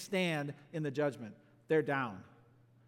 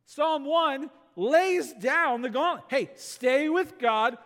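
emphatic preaching; 6 semitones higher, 4 dB louder than usual. Real, not acted. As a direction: lay down the gauntlet to the congregation. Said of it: "This is emphatic".

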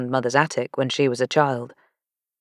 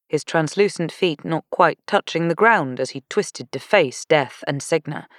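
usual speaking voice

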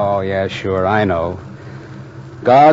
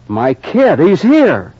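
overjoyed; 8 semitones higher, 3 dB louder, slower than usual. Acted. Father reunited with son.

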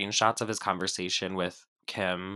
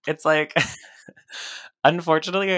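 ordinary delivery

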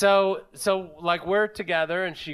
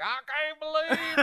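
higher, softer put-on Southern whine; mock Southern character; 6 semitones higher, 5 dB quieter than usual. Comic mocking idea that was the girl, exaggerated Southern voice.

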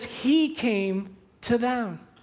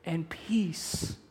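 whispered peace; 4 semitones lower, 8 dB quieter than usual. Real, not acted. Soft close of comforting line.

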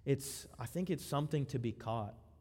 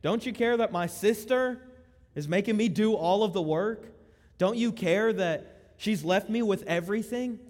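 complaining sister; 6 semitones higher, 7 dB louder than usual. Retold or imitated. Martha complains to Jesus.

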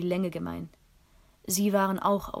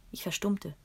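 ordinary delivery, nothing marked

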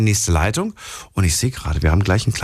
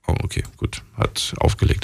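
whispered aside; 3 dB quieter than usual. Real, not acted. Host mutters after caller hangs up.

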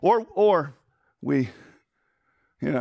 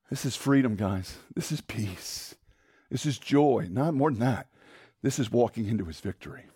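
much softer and lower, tender; hushed sincere; 8 semitones lower, 5 dB quieter than usual. Real, not acted. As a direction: lay it down gently like a blessing. Softer sermon climax.

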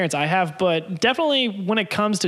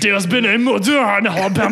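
put-on louder, Frenchified Creole accent; French-tinged Creole accent; 2 semitones higher, 5 dB louder, faster than usual. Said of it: "Creole's like a French".

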